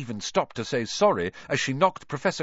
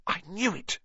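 gasped; 6 semitones higher, 5 dB quieter than usual. Acted. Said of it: "Hermione gasped".

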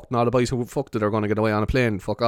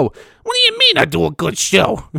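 higher, louder, slow menacing question; hammed-up tough guy menace; Judge Judy impression; 7 semitones higher, 5 dB louder, slower than usual. Judge Judy turning on a fan, hammed up. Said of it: "bad impression of Judge Judy".

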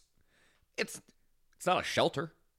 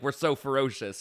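normal talking voice

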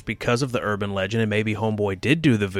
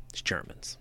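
ordinary delivery

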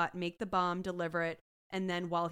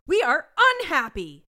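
a much louder, higher hammed-up yell; loud mock shout; 7 semitones higher, 14 dB louder than usual. Quoting Munch in court, a loud hammed-up yell. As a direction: bellow it like the outraged detective. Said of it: "He goes".

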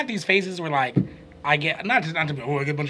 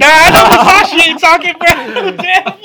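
high, loud laugh-talk; 11 semitones higher, 12 dB louder than usual. Real, not acted. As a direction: shout it through laughter. Still laughing, teasing loudly.

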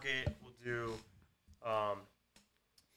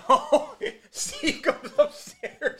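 laughing; 14 semitones higher, 9 dB louder, much slower than usual. Real, not acted. Laughing while retelling the drunk night.

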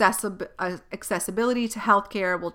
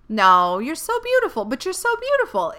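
much higher, squealing fake praise; mocking strangers who gush, high-pitched and louder; over-the-top mock gushing; 8 semitones higher, 5 dB louder than usual. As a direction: ham up the gushing admirer. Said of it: "It's the weirdest".

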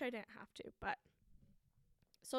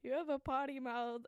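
teasing laugh; 4 semitones higher, 4 dB louder than usual. Retold or imitated. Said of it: "They were like".

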